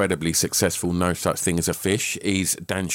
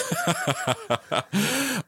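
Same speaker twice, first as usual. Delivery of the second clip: high laugh; 13 semitones higher than usual. Real, not acted. Laughs at cohost's flustered intro.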